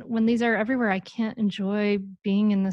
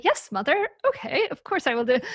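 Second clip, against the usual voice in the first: higher, hammed-up compliant kid; mock obedient child; 6 semitones higher than usual. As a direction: parody a perfectly obedient daughter. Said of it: "it may not magically work. Bing, bing".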